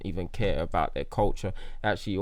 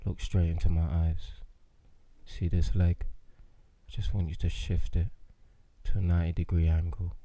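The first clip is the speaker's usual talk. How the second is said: love doctor voice; 3 semitones lower than usual. Pretend. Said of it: "It's gonna be a love doctor".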